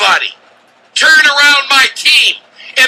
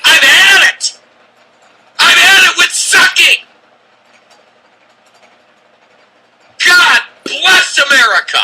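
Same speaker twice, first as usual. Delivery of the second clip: frustrated shouting; 5 semitones higher than usual. Real, not acted. Radio host venting at Browns fans, fed-up shouting. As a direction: let the pent-up frustration burst out on air. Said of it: "You can hear the frustration in my friggin' voice".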